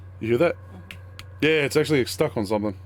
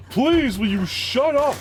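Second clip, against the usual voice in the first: exasperated; 14 semitones higher, 3 dB louder, slower than usual. Real, not acted. Teasing annoyed line.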